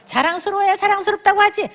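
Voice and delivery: high scoffing retort; mock objection. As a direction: play the skeptic who won't say it. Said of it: "그게 아니고". (비웃듯 높게 반박)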